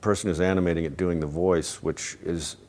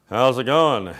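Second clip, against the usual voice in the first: imitated stoner classmate; 2 semitones higher, 4 dB louder, slower than usual. Classmate greets him in the hallway, imitated chuckling stoner. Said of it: "He just always had this laugh".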